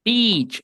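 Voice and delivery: high BEACH with long, spread ee; long i, smiling. Shown as a demonstration. Teacher confirming the word for playa, smiling long i. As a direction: smile through a stretched ee in BEACH. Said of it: "se pronuncia entonces sonriendo".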